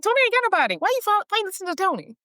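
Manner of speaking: very high-pitched, quick protest; high, exasperated heckle at a film character; incredulous retort. Retold or imitated. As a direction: squeal it in mock disbelief, rushing the question.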